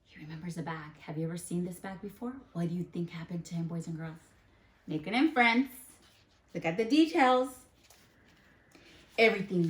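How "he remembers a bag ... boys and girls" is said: fast, much softer suspense; hushed rush. Acted. Teacher rushing the kids along in suspense, fast and soft. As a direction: hurry through it in a hush.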